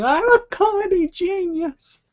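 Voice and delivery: squealed way up high and slow, delighted with himself; gleeful self-praise. Retold or imitated. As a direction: crow it in a giddy high squeal. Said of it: "like, going".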